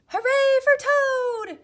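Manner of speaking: very high, loud, slow cheer; shout. Acted. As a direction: cheer Toad from the audience. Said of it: "as he shouted".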